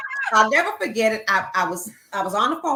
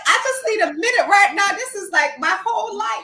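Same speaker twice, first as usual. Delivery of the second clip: higher, louder overwhelmed quote; pitched up, flustered and breathless; half-laughing panic; 11 semitones higher, 7 dB louder than usual. Retold or imitated. Her overwhelmed reply quoted.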